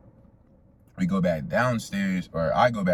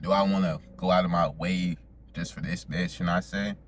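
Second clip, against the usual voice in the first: usual speaking voice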